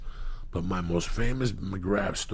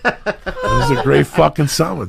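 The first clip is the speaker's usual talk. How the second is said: high, loud laugh into the line; 9 semitones higher, 11 dB louder than usual. Real, not acted. Remembering a wild summer, laughing loud and high. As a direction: laugh through the words, slower.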